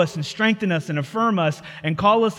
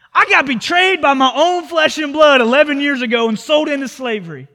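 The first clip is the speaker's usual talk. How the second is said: louder, higher aggrieved outburst; an imagined bitter protest; mock bitter rant; 5 semitones higher, 5 dB louder than usual. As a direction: rant as if wronged. Joseph's imagined bitter complaint.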